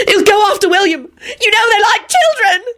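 high, hysterical tearful wail; sobbing. A narrator's reading. Elizabeth fearing the wolf will attack William, sobbing. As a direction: wail it through sobs. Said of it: "Elizabeth sobbed".

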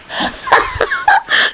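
high-pitched giggling; real giggle. Real, not acted. Listener giggles at the story.

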